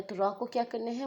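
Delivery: ordinary talk, nothing marked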